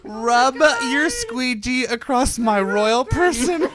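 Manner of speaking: slow, high royal princess voice; lightly drawn-out royal command. Retold or imitated. Repeating the Princess Di clip.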